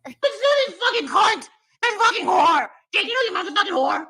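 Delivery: hammed-up furious name-calling; Molly shouting. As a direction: scream insults at the teenager. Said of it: "How do you do impressions so good?".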